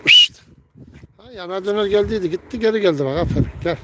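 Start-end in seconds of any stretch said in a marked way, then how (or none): 0.1-0.4 high, piercing whistle; shrill signal whistle to pigeons; sharp whistle. Real, not acted. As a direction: whistle the birds home.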